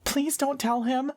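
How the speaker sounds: put-on very high, pleading child voice; high-pitched girl. A narrator's reading. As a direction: beg in a small girl's high pitch. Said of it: "and high-pitched".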